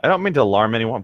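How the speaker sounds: ordinary delivery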